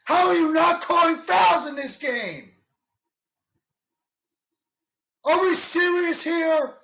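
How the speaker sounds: high, loud disbelieving yell; how is that not a foul; outraged at the refs. Real, not acted. Fan yelling at the refs over a non-call, furious disbelief.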